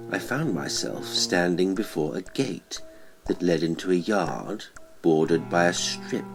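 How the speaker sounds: usual speaking voice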